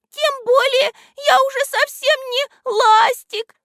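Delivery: very high, loud little voice; тоненький голосок. A narrator's reading. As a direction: squeak it like a tiny character. Тот же тоненький голосок Листика-Ластика. (пискляво, тоненьким голоском)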